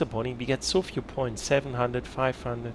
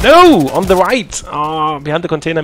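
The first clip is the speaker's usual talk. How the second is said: shouting; 6 semitones higher, 15 dB louder than usual. Real, not acted. Teammate under fire, shouting warning.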